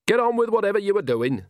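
put-on high, imperious order from an old woman; bossy and snooty, pitched well up; sharp. A narrator's reading. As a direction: send them back to work sharply. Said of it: "she said sharply".

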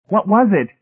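high, loud, frightened question; acted panic, pitched right up; frightened plea. A narrator's reading. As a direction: plead for an answer in fright. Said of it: "David Pritchett pleaded, trembling with fright".

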